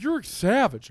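loud and high; mock accusation. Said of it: "He's like".

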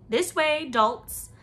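loud, high shout of command; shouted order to the dolts; crying out. A narrator's reading. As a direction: bark it at clumsy helpers. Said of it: "a voice cried".

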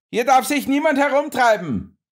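high, sustained call across the yard. A narrator's reading. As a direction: call out to the strangers from the door. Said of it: "rief er uns zu".

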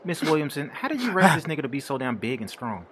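ordinary talk

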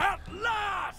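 a sudden high yelp of laughter; high shrieking laugh. Real, not acted. Gamer celebrating a hit, high shrieking laugh. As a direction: burst out in a squealing laugh.

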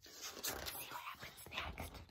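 softly whispered aside. Real, not acted. Parent hushing a child to see what's next, whispered.

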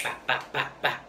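bah bah bah, a choppy mock stutter; imitated stutter. Shown as a demonstration. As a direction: act out a stuck stutter, syllable by syllable. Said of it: "it's just like".

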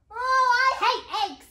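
loud, high, drawn-out complaint; whiny refusal, high and slow; disgusted princess. Acted. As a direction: protest like a fussy child at breakfast.

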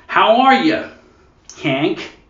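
exaggerated American, high loud swoop; over-effortful American greeting; hammed-up American accent; mock American. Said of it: "calories they must burn while talking".

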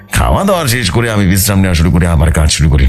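ordinary delivery

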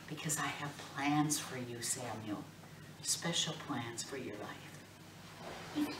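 put-on the Lord promising the boy, softly and slowly; tender divine voice. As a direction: lay it gently, like a blessing.

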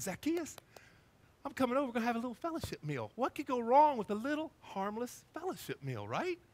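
high, singsong casual invitation; lightly hammed-up "what could go wrong" cheer; cheery self-invited guest. Jesus cheerily inviting himself to dinner.

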